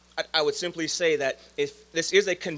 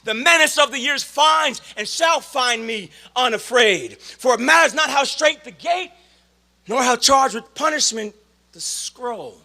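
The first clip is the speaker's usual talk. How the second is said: loud, slow, high-pitched declamation; poem recitation; 8 semitones higher, 7 dB louder than usual. Acted. Speaker reciting a poem to his brothers, loud declamation. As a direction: declaim the poem with full-voiced force.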